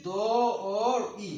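"দ অ ই" তিনটি ধ্বনি টেনে আলাদা করে; থেমে থেমে বলো "দ", "অ", "ই"; ধ্বনি ভেঙে উচ্চারণ. Shown as a demonstration. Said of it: "আমরা উচ্চারণ করেছি". ("দ অ ই" ভেঙে ভেঙে বলা)

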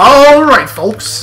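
hammed-up 1950s fight announcer; old-timey boxer announcer. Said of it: "old-timey boxer announcer".

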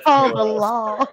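slow East Texas drawl; playful, drawn-out East Texas call; mock East Texas accent. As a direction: echo the East Texas cry, stretched out. Echoes the East Texas cry.

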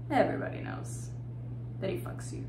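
voiceless, near-whispered taunt; hushed cruelty. Acted. Woman exposes friend's secret, hushed taunt. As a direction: let it drop to a whisper.

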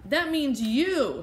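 slow, stern warning from the policeman. Acted. Policeman scolding the girl, slow and stern. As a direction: lay down the law slowly. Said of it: "said the policeman".

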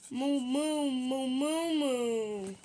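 drawn-out mournful cow moos; mopey pretend cow; sad moo. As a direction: moo sadly, sinking at the end. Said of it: "Why you look so sad".

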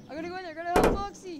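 high, rising to a loud cry; urgent cry. Acted. Character rushing to rescue Foxy, urgent. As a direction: pitch it up and build to a yell. Character rushes to help a friend.

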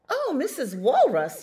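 high, loud chick's call; crying out. A narrator's reading. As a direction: hail her loudly as an eager little bird. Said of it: "he cried".

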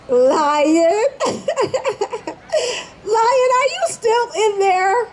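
high, giggling sing-song call; teasing call. Acted. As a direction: tease him through the door. Rabbit taunts the weak lion.

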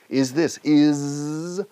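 "is" with a long voiced z; buzzing S. Shown as a demonstration. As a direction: hold the buzz at the end of "is". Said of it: "es un sonido que no existe en español".

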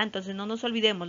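ordinary delivery

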